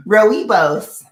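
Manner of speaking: "Rooibos" belted out high and loud; knowingly wrong pronunciation. Shown as a demonstration. Tea drinker sure she mispronounces it, saying "Rooibos" loud and high. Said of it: "I'm sure I'm pronouncing it wrong".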